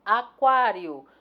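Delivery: "Aquário" slow and loud, stressed on "quá"; stress on penultimate syllable. Shown as a demonstration. Teacher correcting the exercise, "Aquário" stressed on "quá". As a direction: stretch "Aquário", punching the "quá". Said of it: "uma paroxítona terminada em ditongo".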